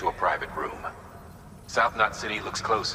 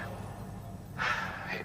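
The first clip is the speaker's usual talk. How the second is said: weary sigh; 4 semitones higher, 8 dB quieter than usual. Acted. Weary sigh after news.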